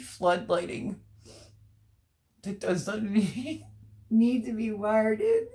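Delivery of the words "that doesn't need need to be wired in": real helpless laughter breaking through the words; laughing to tears. Real, not acted. Host reading a viewer's comment, cracking up in laughing tears. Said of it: "Look, I'm about ready to cry".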